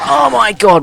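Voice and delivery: sudden high yelp of fright; startled shriek. Real, not acted. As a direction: jolt as if something leapt out. Jump scare in game.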